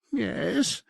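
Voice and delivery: a high, grudging "Yes" from the demon; bitter concession. A narrator's reading. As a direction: give in resentfully, pitched high. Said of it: "it conceded bitterly".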